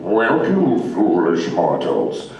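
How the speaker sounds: slow, low ghost host; a hint of a spooky mansion host; Paul Frees voice. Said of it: "the voice that you hear all through Manhattan".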